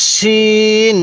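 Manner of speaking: শিন with a thick sh, vowel held long; teacher's slow, thick-sounded শিন; thicker শিন. Shown as a demonstration. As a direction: draw out শিন on a level note with a full sh. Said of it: "শিনটা একটু মোটা করে উচ্চারণ করব".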